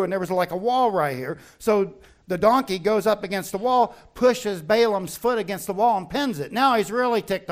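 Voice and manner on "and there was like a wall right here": ordinary talk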